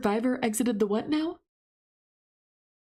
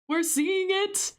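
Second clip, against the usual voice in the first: high, slow, drawn-out wonder; awed excitement; 9 semitones higher, slower than usual. Real, not acted. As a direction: savor it, higher and slower.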